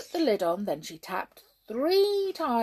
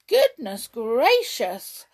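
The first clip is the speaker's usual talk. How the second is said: loud, slow astonished gasp; gasping surprise; 5 semitones higher, 11 dB louder, slower than usual. Acted. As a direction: gasp it out in shock. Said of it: "gasped Jump".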